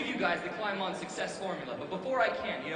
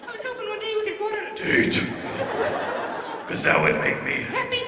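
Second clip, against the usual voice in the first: puberty voice cracking; 12 semitones higher, 10 dB louder than usual. Acted. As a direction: crack wildly mid-phrase like a pubescent announcer. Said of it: "His voice cracking and everything".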